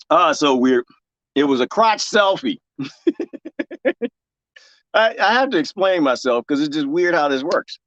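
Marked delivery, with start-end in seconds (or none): 2.8-4.1 high giggle, on the soft side; real laugh. Real, not acted. Admitting it was a crotch selfie, high giggle. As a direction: break into a light, high giggle.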